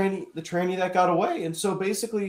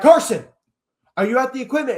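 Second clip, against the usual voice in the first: raised, high-pitched demand; retold urgent call; 9 semitones higher, 8 dB louder than usual. Calling a friend back about equipment, acted urgency. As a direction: call his name loudly, then press the question.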